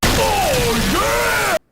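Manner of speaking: sudden very high, loud "Oh, yeah!"; a jokey wall-smashing yell; shouted Oh yeah. Pretend. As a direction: burst in like the Kool-Aid Man. Kool-Aid Man style outburst.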